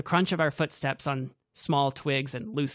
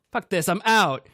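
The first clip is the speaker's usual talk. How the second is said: loud, higher angry outburst; angry exit; 6 semitones higher, 7 dB louder than usual. A narrator's reading. Grant storming off, loud and furious. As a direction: spit it out storming off.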